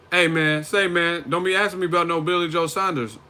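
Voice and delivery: loud, high American street voice; imagined accentless Golovkin. Pretend. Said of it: "What if Golovkin didn't even have an accent?".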